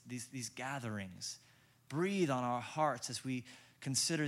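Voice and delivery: soft, earnest pastoral prayer. Acted. Pastor praying for his congregation, soft and earnest. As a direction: pray quietly over the people, unhurried. Pastor prays after the reading.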